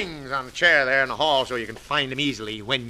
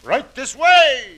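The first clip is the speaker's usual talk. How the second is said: bellowed, slow and booming; loud; 11 semitones higher, 11 dB louder, much slower than usual. Acted. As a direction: blast it out like crashing barrels. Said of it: "rush in like a sound of trash barrels being tipped over".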